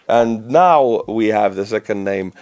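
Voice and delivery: ordinary delivery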